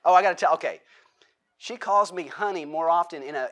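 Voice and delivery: normal talking voice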